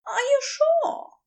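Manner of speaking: anxious check on him; worried Aziraphale. Acted.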